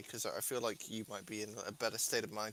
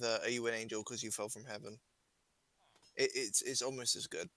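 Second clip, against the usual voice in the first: usual speaking voice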